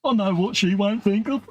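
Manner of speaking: a light mocking impression of a husband; wife's mocking voice. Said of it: "And she done the voice".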